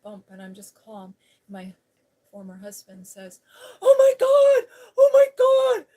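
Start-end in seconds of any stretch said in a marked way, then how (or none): 3.8-5.9 panicked high screaming, full blast; losing it. Retold or imitated. Ex-husband to driver after deer hit the van, screaming hysterically. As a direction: scream hysterically, far higher and louder than usual. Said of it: "he's, like, losing it".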